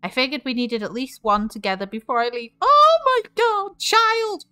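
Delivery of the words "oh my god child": much higher, slow, gushing cry; overjoyed dad. Acted. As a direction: squeal it like a proud parent. Dad moved by daughter's gift.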